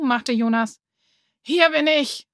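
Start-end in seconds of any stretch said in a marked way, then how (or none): 1.4-2.3 higher call, a touch out of breath; lightly voiced puffing arrival; puffing pregnant woman. A narrator's reading. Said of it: "schnaufte eine sehr schwangere Frau".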